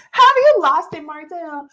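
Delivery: shrieked high and loud, then dropping off; disbelieving cry aimed at the screen; loud mock outrage at a delusional husband; incredulous.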